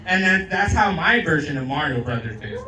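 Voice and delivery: ordinary talk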